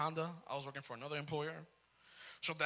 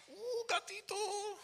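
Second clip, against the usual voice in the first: much higher, slow swooning coo; delighted wife; much slower than usual. Retold or imitated. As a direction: play the thrilled wife. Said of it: "She will open it up".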